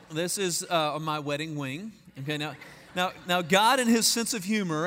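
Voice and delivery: ordinary delivery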